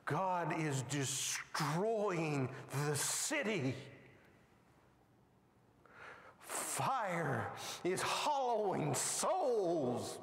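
very slow, grave telling; preacher slowing for gravity; solemn slow. Real, not acted. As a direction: draw out each word with dread.

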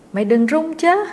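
loud, high hushed-urgent call. A narrator's reading. Boy calling up from the doorway, loud and urgent. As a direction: shout up a warning not to shake.

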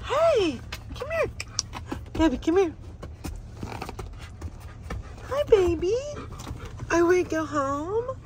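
high sing-song calling. Real, not acted. Calling the dog to come.

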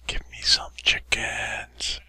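slow pleading whisper; whispered plea. Real, not acted. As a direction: beg in a whisper.

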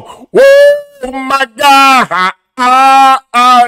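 very high, loud whoa then gasping ahs; stunned shock. Acted. Reacting to the Philly insult, loud stunned shock. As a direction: cry out like you can't believe it.

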